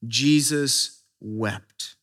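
Jesus wept read slowly and gravely; a grave, drawn-out reading of Jesus wept; slow solemn reading. Shown as a demonstration. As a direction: let each word hang, hushed. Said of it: "It says what?".

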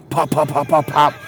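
rapid, punchy popping; imitated gunshots. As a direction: fire off pistol shots with your mouth. Imitates firing his pistol.

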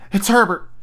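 high, sudden exclamation; excited cry. A narrator's reading. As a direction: cry out in joyful recognition. Mother believes her son returned.

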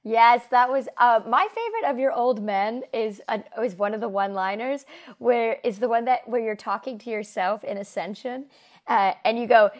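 usual speaking voice

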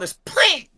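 high, loud little pling noise; sharp high ping imitation; imitated pling sound. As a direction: squeak out the pling like a cartoon sound. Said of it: "there was this sound".

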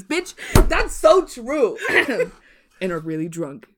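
usual speaking voice

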